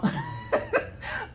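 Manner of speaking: a sudden high-pitched giggle at herself. Real, not acted. Laughing at her own lazy eye, high giggle. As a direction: burst into a squeaky laugh.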